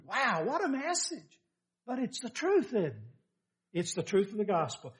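ordinary talk, nothing marked

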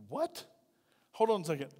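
retold quiet double take; taken aback. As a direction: softly squeak out your disbelief. His shocked reaction to an accusation.

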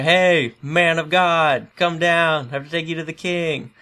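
high, loud call up the hill, slow on man of God; mock-bellow at the prophet to come down; hammed-up captain's shout; yelling captain. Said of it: "he starts yelling at Elijah".

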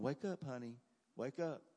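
softly coaxing someone out of sleep; gentle mama wake-up. Retold or imitated. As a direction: imitate Mama waking a child gently. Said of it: "like Mama used to wake me up".

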